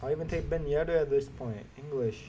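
normal talking voice

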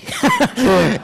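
high burst of real laughter; loud laugh. Real, not acted. Laughs at own joke.

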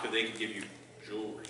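ominous punchline. Shown as a demonstration. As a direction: let the punchline sink away quietly. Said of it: "Don't mess with cops".

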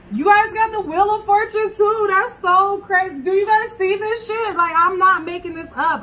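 high, loud astonished exclaiming; real shock at the same card turning up; amazed. Real, not acted. Amazed at matching cards.

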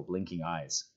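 plain reading voice

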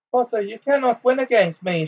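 high-pitched cry of defiance. A narrator's reading. Bellatrix taunts Potter as they duel, crying out high. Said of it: "she cried".

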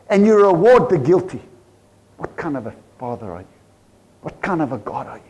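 ordinary talk, nothing marked

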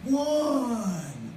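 high, drawn-out umpire call of strike one; umpire's call. Retold or imitated. Umpire calling the pitch at Yankee Stadium, high ringing call. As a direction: sing out the call like an umpire behind the plate. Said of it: "and you hear".

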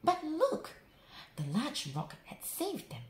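ordinary delivery

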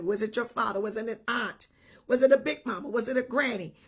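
ordinary delivery

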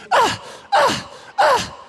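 high exaggerated moaning ahs; mock female moans. Woman in bed moaning to flatter her man, high mock moans. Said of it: "you hear this".

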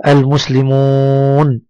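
المسلمون slowly, with a very long oo; drawn-out madd. Shown as a demonstration. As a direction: hold the final oo of المسلمون steady. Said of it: "مد بالواو". (مدّ الواو طويلًا في المسلمون)

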